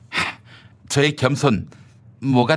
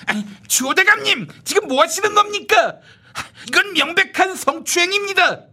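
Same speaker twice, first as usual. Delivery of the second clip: much higher protesting voice; indignant young upstart; 13 semitones higher than usual. Acted. Upstart protesting to minister, higher indignant. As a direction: play the shocked junior crying foul.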